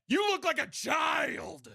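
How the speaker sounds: high, slow mocking yell; mocking shout. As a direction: yell the insult slowly and high. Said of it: "make fun of how they look".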